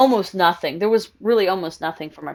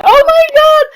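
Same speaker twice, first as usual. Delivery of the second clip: high, loud excited squeal; starstruck gasp; 9 dB louder than usual. Retold or imitated. Idols arrive at her event.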